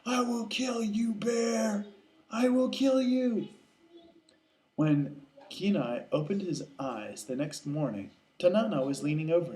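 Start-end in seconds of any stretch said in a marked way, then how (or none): 0.1-3.5 high, drawn-out vow to kill; vengeful vow. Acted. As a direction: call the threat out high and sustained. Acted vow of revenge in story.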